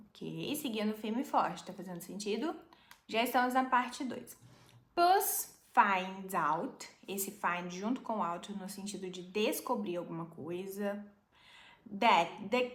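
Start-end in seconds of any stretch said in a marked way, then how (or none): 4.9-6.9 slow model reading. Shown as a demonstration. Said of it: "slow model reading".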